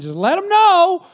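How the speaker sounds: high, loud and drawn out; rising loud flourish; emphatic call. Acted. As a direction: belt it out to show who's boss. Urging parents to assert authority.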